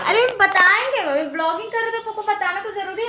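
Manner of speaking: loud, high-pitched protest to mum; real indignant outburst. Real, not acted. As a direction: raise pitch and volume to object. Protesting to her mother's scolding.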